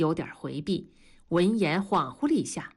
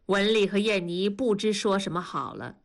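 plain reading voice

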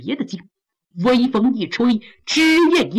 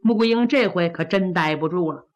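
ordinary delivery, nothing marked

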